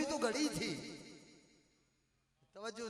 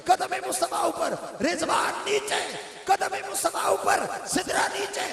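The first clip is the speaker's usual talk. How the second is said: higher and louder, building to a roar; climactic preaching shout; 5 semitones higher, 4 dB louder than usual. Acted. Preacher lifting the congregation to the climax, building shout. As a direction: drive the refrain up to its peak.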